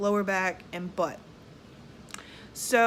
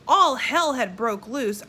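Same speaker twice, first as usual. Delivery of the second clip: ordinary delivery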